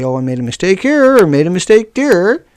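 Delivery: sing-song mock admission; mock apology. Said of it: "try to say".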